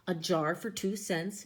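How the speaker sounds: usual speaking voice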